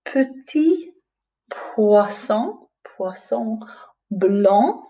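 put-on slow, lilting read-aloud for little children; storybook reading. Teacher reads a French picture book aloud to the class, slow and lilting. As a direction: read it like bedtime to young pupils.

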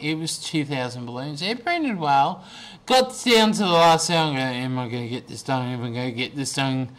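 usual speaking voice

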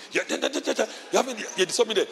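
usual speaking voice

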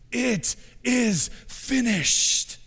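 high, drawn-out slow cry; crucified Jesus screaming. Retold or imitated. Pastor voicing Jesus' last scream, high and slow. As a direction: stretch it out as one long anguished cry. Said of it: "and he screams".

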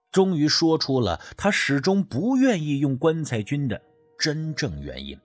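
ordinary delivery, nothing marked

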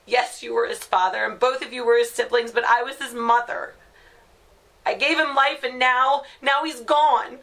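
grief building into loud, high-pitched fury; anguished angry outburst. Acted. Grieving mother telling her family to leave her alone, furious. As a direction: start firm, rise to a raw outburst.

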